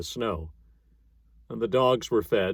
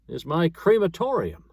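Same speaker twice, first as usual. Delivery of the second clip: sudden cry; 7 semitones higher than usual. Acted. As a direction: announce it with sudden triumph. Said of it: "with a sudden cry".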